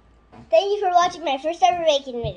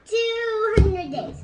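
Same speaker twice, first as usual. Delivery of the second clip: drawn out; 6 semitones higher, 4 dB louder, much slower than usual. Pretend. Child playful drawn-out answer.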